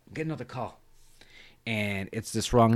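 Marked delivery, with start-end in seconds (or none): 0.1-0.8 much softer, dropped away; offhand repeat. Retold or imitated. Cotton ending the agent's call, offhand. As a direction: toss it off under your breath.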